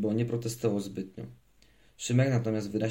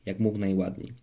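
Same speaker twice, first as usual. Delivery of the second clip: ordinary delivery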